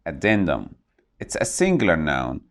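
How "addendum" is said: addendum with the weight on DEN; stress on second syllable. Shown as a demonstration. Teacher models the word addendum for learners, stressing the second syllable. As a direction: say addendum as a-DEN-dum, hitting the middle syllable clearly. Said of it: "The stress is on the second syllable".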